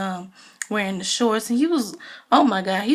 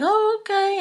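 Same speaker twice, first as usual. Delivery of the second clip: mock knowing oh okay; 11 semitones higher than usual. Everybody reacts skeptically.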